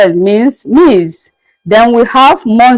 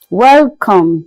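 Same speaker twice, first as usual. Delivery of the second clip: ordinary talk, nothing marked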